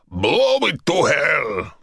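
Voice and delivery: slow, seething roar; raging. A narrator's reading. General ordering the ambulance destroyed, raging. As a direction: rage the order out. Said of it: "the general raged".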